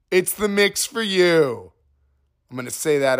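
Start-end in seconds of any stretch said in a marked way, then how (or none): 0.1-1.7 high, bright parody commercial voice, sing-song; over-the-top jingle announcer, pitched way up; mock ad read. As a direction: sell trail mix like a cheesy radio ad. Mock ad read for Trail Mix.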